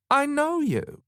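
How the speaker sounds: high-pitched exclamation of recognition; exclaiming. A narrator's reading. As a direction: blurt it high as you recognise them. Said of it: "she exclaimed".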